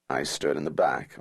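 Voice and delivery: ordinary delivery, nothing marked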